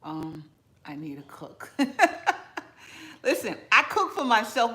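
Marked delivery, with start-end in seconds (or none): 1.8-3.2 high laugh. Real, not acted. Laugh at own joke.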